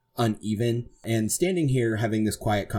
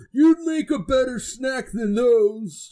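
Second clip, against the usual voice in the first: loud, slow, pitched-up ogre moan; 14 semitones higher, 5 dB louder, slower than usual. Acted. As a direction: menace them in a long-drawn ogre wail. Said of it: "the ogre says".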